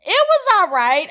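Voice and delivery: damn the shoe with faint praise, pitch way up; unimpressed shrug. Real, not acted. Vlogger judging the new sneaker, high and unimpressed.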